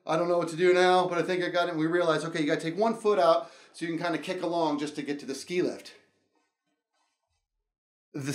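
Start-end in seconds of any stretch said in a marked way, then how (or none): none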